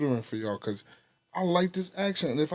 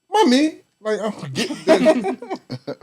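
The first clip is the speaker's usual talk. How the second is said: mock British Mommy; 8 semitones higher, slower than usual. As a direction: copy how a Londoner says "Mommy", pitched up. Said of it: "the sounds, like".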